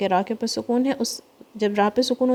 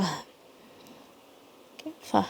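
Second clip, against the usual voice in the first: whisper; 12 dB quieter, much slower than usual. Real, not acted. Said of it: "teacher whispers aside".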